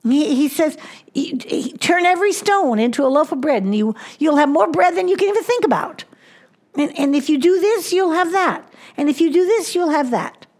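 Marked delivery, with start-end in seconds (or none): 1.1-10.5 higher, fast, coaxing devil's pitch; the devil tempting Jesus, higher and fast. Acted. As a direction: sell it like a smooth tempter. Preacher voices the devil's temptation.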